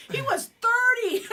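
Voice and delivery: high, loud, laughing exclamation; laughing tease. Real, not acted. Wife teasing her husband about his age, laughing. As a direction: blurt it out loud and high, amused.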